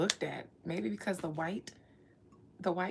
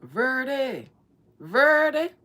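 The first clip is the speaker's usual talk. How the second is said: loud, high grandma calling out a name; imitating a grandma calling across the house; 3 semitones higher, 12 dB louder, much slower than usual. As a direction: holler her name like a grandma from the porch. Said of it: "how your grandma used to call you".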